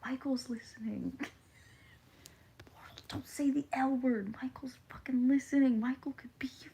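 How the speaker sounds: conspiratorial whisper, breathy and hushed; mock whisper. Warning chat in a mock conspiratorial whisper. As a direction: hush them like Michael might hear. Secret warning about the mod.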